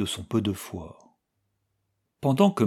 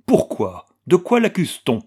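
high, loud, imperious questioning; haughty; 8 semitones higher, 6 dB louder than usual. A narrator's reading. Anna challenges news of her fiancé's arrest, haughtily. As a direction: demand an answer, head held high. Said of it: "demanda-t-elle d'un ton altier".

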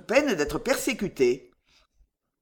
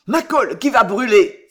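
loud, raised cry of alarm; alarmed shout at something burning; exclaimed; 4 semitones higher, 9 dB louder than usual. A narrator's reading. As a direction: burst out in sudden comic panic. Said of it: "s'écria-t-il".

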